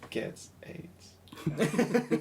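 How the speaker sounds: slow words through a laugh. Real, not acted. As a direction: laugh while dropping the punchline. Deadpan punchline with laugh.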